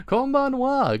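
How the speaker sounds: loud, very high held greeting; high, stretched comic hello; high-pitched greeting. Acted. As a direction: squeal out a playful greeting. Mock Japanese greeting after laughing.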